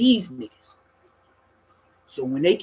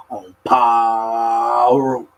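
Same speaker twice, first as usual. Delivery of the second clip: low, very slow, drawn-out oath; slow drawn-out imitation; 6 semitones lower, 4 dB louder, much slower than usual. Imitating Dulo's slow solemn oath, low. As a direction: stretch it out like a solemn sentence. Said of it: "He used to say it like this".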